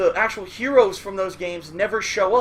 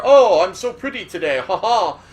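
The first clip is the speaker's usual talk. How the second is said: louder, preening vain hero voice; vain Simon; 7 dB louder than usual. Retold or imitated. Simon Belmont admiring himself in the mirror, preening vain voice. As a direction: admire yourself in the mirror, aloud. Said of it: "he would look at himself in the mirror".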